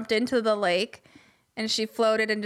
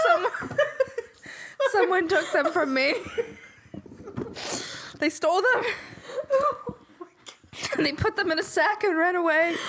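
laughing mock lament; 6 semitones higher than usual. Real, not acted.

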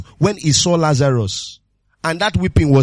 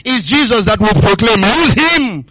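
full-force preaching; 5 semitones higher, 7 dB louder than usual. Acted. Preacher driving the message home, preaching at full force. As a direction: hammer each word home to the church.

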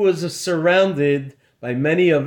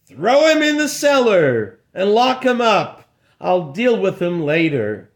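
higher, louder angry order; angry command; 4 semitones higher, 3 dB louder than usual. Acted. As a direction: bark the order in anger. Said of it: "could hardly hold back his anger".

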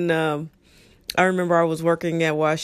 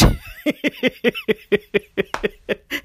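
laughing; 10 semitones higher than usual. Real, not acted. Laughing at punchline.

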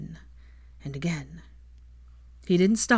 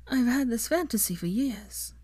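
higher, shy woman's voice; young woman voice; 6 semitones higher than usual. A narrator's reading. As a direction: confess it shyly. Female character voiced.

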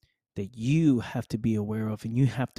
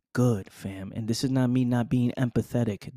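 normal talking voice

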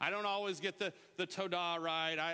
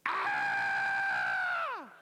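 put-on loud child's scream of joy; excited scream; 7 dB louder than usual. Grace greeting grandparents with a joyful shout. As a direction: scream like a kid seeing her grandparents. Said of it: "She went".

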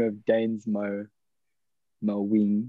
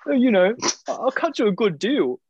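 mock friendly tradie; 11 semitones higher, 3 dB louder than usual. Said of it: "He's like".

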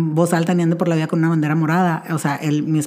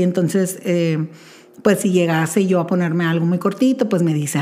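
ordinary delivery

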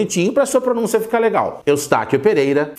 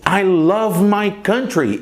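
very slow, carefully spoken example; slow model sentence; 2 semitones higher, much slower than usual. Shown as a demonstration. Teacher slowly modeling an example sentence. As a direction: stretch out the example for learners. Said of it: "Por exemplo".

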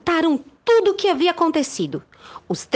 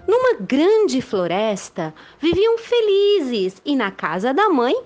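lively storybook narration; reading to children; 3 semitones higher than usual. A narrator's reading. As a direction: tell it like a bedtime tale.

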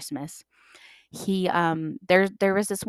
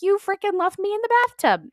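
high, louder indignant squeal; mock outrage; 14 semitones higher, 6 dB louder than usual. Jordan protesting to siblings, high indignant squeal. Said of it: "And I was like".

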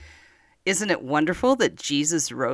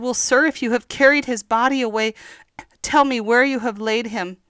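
higher, louder, quick pleading; pleading Mary; 9 semitones higher, 4 dB louder than usual. Acted. Mary begging the man she thinks is the gardener, higher pleading. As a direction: beg the gardener for an answer. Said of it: "she said".

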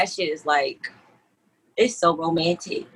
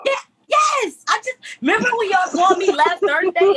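excited shout; 11 semitones higher than usual. Real, not acted.

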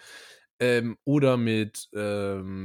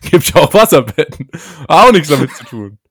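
louder, laughing comeback; laughing retort; 2 semitones higher, 9 dB louder than usual. Shown as a demonstration. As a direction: blurt it out through laughter. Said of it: "Was sagst du denn als Gegenüber?".

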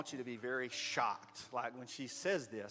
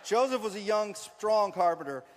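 story narration; 6 semitones higher, 9 dB louder than usual. Acted. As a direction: draw out the phrases with pauses. Narrates nativity play for children.

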